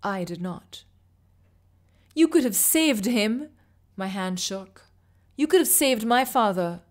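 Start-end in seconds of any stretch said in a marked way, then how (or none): 2.1-3.5 loud, higher accusing cry; angry outburst. Acted. Furious accusation, hand shaking. 5.4-6.8 angry outburst. Acted. Furious accusation, hand shaking.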